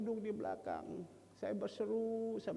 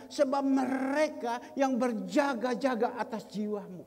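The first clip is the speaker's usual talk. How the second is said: loud, raised preacher's proclamation; full-force preaching; 5 semitones higher, 9 dB louder than usual. Acted. Preacher reading Jonah's flight to the congregation, full-force preaching. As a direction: declaim the scripture loudly, drawing out key words.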